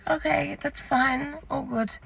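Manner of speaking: soft, uneasy let-down; awkward backing away. Retold or imitated. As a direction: imitate someone retreating politely. Staff member backing away awkwardly.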